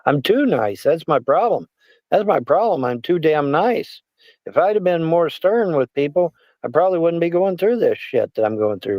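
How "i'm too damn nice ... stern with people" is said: ordinary talk, nothing marked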